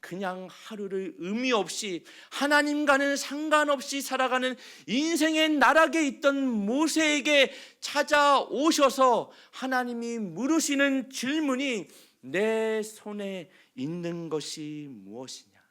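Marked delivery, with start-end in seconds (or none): 2.3-11.9 loud, high sermon climax; full-force preaching. Acted. Pastor warning churchgoers about resignation, full-force preaching. 12.2-15.6 slow, solemn divine question; God's question. Acted. God asking Moses what is in his hand, slow and solemn. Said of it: "이 말씀입니다".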